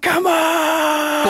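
high, loud victory yell; big cocky sports shout; loud celebrating shout. Retold or imitated. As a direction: roar it like you just won the point. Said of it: "started celebrating every point incredibly loudly".

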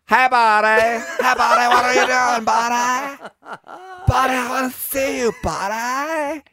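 very high, shouted weird impression; Andy Dick. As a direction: yell it in a far-up comic celebrity voice. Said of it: "Andy Dick".

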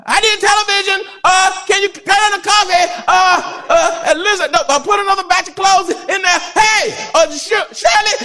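high, loud hollering at home; hammed-up loud spouse shouting to Shirley; mock loud talker. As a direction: holler household orders across the house. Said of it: "you just talk loud".

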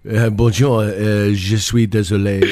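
low, hesitant French accent; mock French accent. Shown as a demonstration. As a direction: put on a low French voice, fumbling for words. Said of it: "Number five is French".

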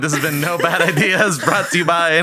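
loud mock host outro; podcast sign-off. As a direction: wrap up like a radio host.

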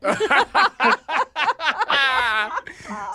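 rising giggle; laughing out at the end of the story; long laugh. Real, not acted. Caller laughs at own story.

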